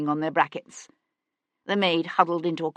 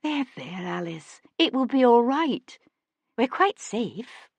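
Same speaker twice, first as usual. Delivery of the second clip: lady calming the sobbing maid, gently; gentle comfort; 6 semitones higher, slower than usual. A narrator's reading. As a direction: hush her tears tenderly. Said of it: "said gently".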